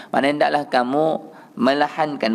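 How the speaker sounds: ordinary delivery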